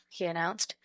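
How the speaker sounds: ordinary delivery, nothing marked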